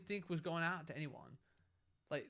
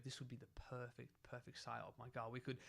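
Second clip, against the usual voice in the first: low, much softer imagined plotter's aside; hushed scheming; 5 semitones lower, 10 dB quieter than usual. Retold or imitated. Imagining a hoaxer planning a psyop, hushed scheming. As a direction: drop right down as if quietly hatching a plan.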